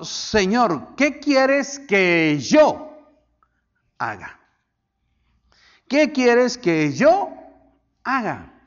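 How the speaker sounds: slow, high rise on "yo", then a hushed "haga"; trembling and fearful. Retold or imitated. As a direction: act out a fearful plea, drawn out with a long pause. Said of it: "Él temblando y temeroso dijo". (imitando una súplica temerosa, lenta y entrecortada)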